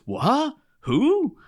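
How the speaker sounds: high, shocked squeak; startled what who. Retold or imitated. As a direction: jump up in pitch, baffled. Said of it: "Dick's exact reaction is".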